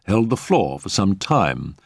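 ordinary delivery

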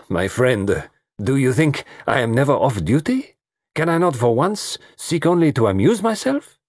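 mildly wounded, rising questions; Poirot, protesting. Acted. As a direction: plead to be allowed a night off.

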